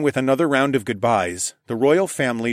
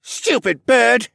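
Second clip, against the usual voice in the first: put-on high, slow, louder kid voice; annoyed boy; 13 semitones higher, 6 dB louder, much slower than usual. A narrator's reading. Stable boy cursing the bird, high annoyed. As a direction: spit it out at the bird.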